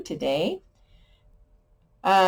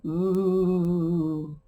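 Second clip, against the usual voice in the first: a ghostly drawn out "Ooh"; spooky ghost ooh; 3 dB quieter than usual. Pretend. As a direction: moan "Ooh" like a friendly ghost. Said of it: "they're saying".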